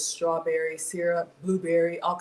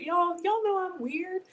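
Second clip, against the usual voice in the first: playful; 12 semitones higher than usual. Real, not acted. Playful self-description.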